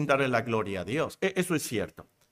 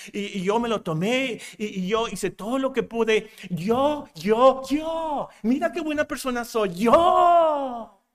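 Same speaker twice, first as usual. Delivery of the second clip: higher, louder hammed-up bragging; mock boasting; 5 semitones higher, 3 dB louder than usual. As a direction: parody a proud man shouting about himself. Mocking a boastful self-praiser. (presumido exagerado, gritando "Yo, yo")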